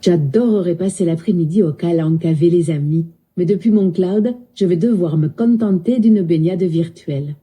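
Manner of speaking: lilting southern French accent. Shown as a demonstration. Voice assistant obliging a user, in southern French accent. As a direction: imitate a Midi accent, sounding final vowels. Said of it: "parle avec l'accent du sud".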